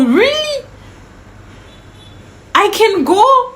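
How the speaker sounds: very high, offended questioning; high-pitched indignant double take; incredulous disbelief. Retold or imitated. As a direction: echo it back in outraged astonishment. Disbelief at being dismissed.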